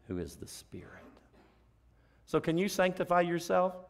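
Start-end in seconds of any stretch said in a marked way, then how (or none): 0.1-1.0 soft unvoiced whisper; whispered reverent close. Real, not acted. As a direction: breathe it out in a hush.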